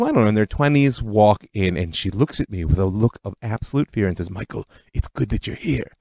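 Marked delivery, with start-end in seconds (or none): 4.3-5.9 put-on softer, thick Eastern European accent of an old aunt; a retold Eastern European accent, quiet and worried. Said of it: "in her thick Eastern European accent".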